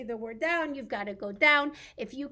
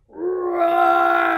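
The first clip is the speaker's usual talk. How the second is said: long, loud, high roar; dragon roar; 6 semitones higher, 16 dB louder than usual. Pretend. Being the rhyme's dragon for toddlers, big roar. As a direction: roar at full voice, playfully.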